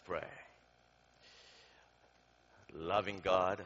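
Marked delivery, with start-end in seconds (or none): none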